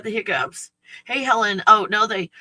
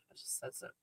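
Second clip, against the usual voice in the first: whisper; 26 dB quieter, faster than usual. Real, not acted. Trailing off unvoiced.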